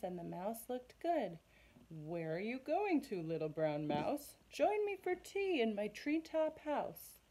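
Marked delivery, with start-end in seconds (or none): 1.9-7.2 high, louder owl; a grand owl inviting to tea; hooty owl voice. Acted. Owl inviting the mouse to tea, hooting.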